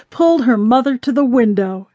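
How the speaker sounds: ordinary delivery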